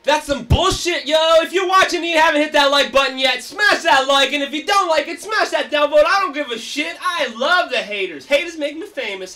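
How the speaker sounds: loud, pumped call to smash that like; hyped-up shout. Real, not acted. Host rallying stream viewers, loud and pumped. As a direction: fire up the chat like a hype man.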